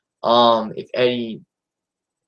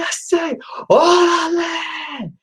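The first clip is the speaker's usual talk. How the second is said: much higher, stretched rallying yell on órale; drawn-out shout; much slower than usual. Retold or imitated. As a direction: belt órale out long like a wrestling catchphrase. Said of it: "I'll do my best Eddie impersonation".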